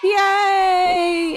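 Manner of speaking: high cheering yay, shouted on the second. Pretend. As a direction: celebrate the donation with a big yay. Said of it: "I'm gonna do the yay".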